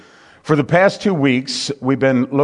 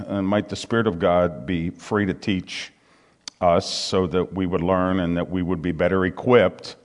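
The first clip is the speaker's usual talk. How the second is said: pastor's prayer; 3 semitones lower than usual. Real, not acted. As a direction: pray sincerely over the congregation. Leads prayer before study.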